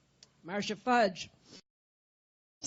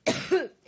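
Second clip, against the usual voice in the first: rough hacking cough; chesty cough; 6 semitones higher, 5 dB louder than usual. Real, not acted. Coughing through a speech.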